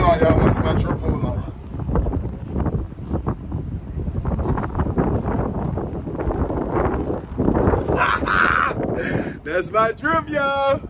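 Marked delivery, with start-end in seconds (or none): 1.4-9.4 long loud shouting laugh while showing off. Real, not acted. Rapper showing off his new look, loud laugh. 9.4-10.8 higher and louder, proud; excited brag. Real, not acted. Rapper showing off his drip to viewers, excited and loud.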